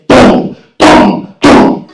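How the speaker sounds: loud dull stomping sounds; heavy footsteps. Retold or imitated. Boy hearing the dad climb the stairs, stomping sounds. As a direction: thud out each step.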